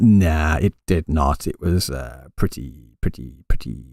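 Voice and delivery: very low, quiet, drawn-out letdown; unimpressed. Real, not acted. Streamer answering whether the stream delivered, low and unimpressed. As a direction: sink deep and soft, reluctant to praise. Giving a reluctant verdict on a stream.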